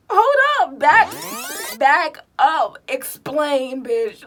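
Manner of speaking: loud mock-furious demand; indignant yell. Confronting the girl who dated Ted. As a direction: bark it like you're offended.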